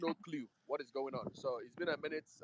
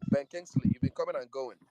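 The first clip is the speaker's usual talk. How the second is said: calling out; 2 semitones higher, 10 dB louder than usual. Real, not acted. Host calling out an absent speaker.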